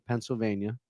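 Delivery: normal talking voice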